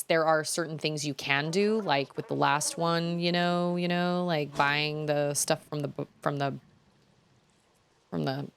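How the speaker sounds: ordinary talk, nothing marked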